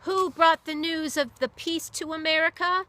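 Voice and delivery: high, loud rallying shout; yelled question. Acted. Sailor yelling questions at the crowd, high and loud. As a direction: whip up the crowd with it. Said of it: "yelled questions".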